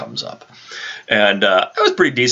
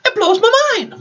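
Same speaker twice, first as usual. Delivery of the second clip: very high, squeaky voice for the little dog; squeaky pet voice. Acted. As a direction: pipe it up like a tiny yappy dog. High squeaky voice talking about dog.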